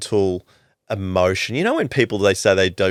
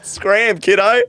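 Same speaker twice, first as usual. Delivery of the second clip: shark shooing diver; 12 semitones higher, 4 dB louder, much slower than usual. Retold or imitated. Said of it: "Yeah, yeah".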